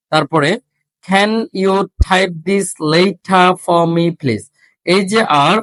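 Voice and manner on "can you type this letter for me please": very slow, deliberate reading with breathy stops; slow drill. Shown as a demonstration. Teacher slowly modelling a question for students. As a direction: read it slowly, puffing each stop. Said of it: "আর এর উচ্চারণটা হচ্ছে না".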